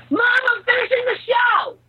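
high shout through the house; calling out. Real, not acted. Calling out to mom downstairs.

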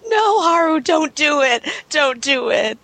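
imitating a tied-up girl begging, lightly; mock crying plea. Retelling the anime scene, a mild mock sobbing plea. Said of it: "she's just kinda, like, crying".

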